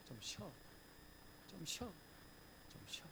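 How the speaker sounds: soft, tender reassurance; gentle rest. Pretend. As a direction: hush them to rest like a parent. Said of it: "말씀하시지".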